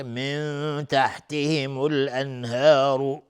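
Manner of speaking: slow recital, nasal held nun in من تحتهم; ikhfa demonstration. Shown as a demonstration. Teacher shows ikhfa in من تحتهم, slow chanted hum on the nun. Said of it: "نون ساکن بهش تای دو نقطه اخفای حقیقی".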